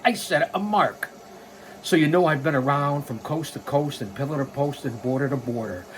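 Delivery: ordinary talk, nothing marked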